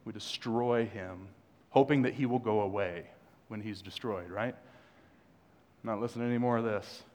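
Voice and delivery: usual speaking voice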